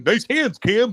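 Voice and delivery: high-pitched mock taunt; mocking chirp. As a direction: imitate an opponent needling you. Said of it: "this kid's chirping me".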